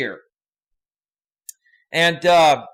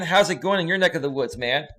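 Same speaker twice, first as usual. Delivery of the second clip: ordinary delivery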